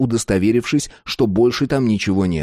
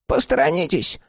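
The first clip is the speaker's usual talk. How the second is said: high, impatient crone voice; old woman. Acted. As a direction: order him aside in a high old woman's voice. Old woman takes over.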